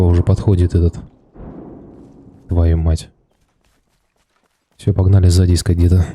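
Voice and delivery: normal talking voice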